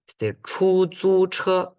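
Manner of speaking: chu zu che slow, high and level, almost sung; all first tone. Shown as a demonstration. Teacher shows the all-first-tone taxi word. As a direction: sing chu zu che on one high pitch. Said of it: "Como cantado".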